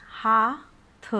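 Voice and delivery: हाथ drawn out very slowly; slow syllable. Shown as a demonstration. Teacher sounds out हाथ.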